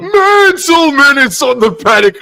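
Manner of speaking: co-commentator hollering a player's arrival; excited shout. Real, not acted. Player enters, co-host reacts.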